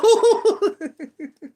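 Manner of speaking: high bubbling laugh; bright laugh. Real, not acted. Reader laughing after naming friends with her number. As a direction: let a real giggle burst out.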